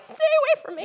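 high frightened plea; scared movie line. Retold or imitated. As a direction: imitate a terrified scream-line. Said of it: "You know when she's like".

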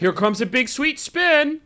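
slow, drawn-out sportsy hype; hammed game voice line. Mock voice-over take for a ping pong game, hammed up. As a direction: oversell it like a game character. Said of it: "Another take?".

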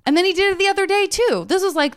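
ordinary delivery

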